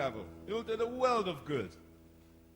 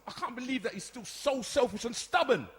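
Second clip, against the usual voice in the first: loud, raised, resentful rant; angry outburst; 6 semitones higher, 6 dB louder than usual. Acted. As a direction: let years of resentment out at mum. Rant to mother about favoured brother.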